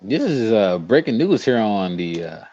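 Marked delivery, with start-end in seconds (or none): none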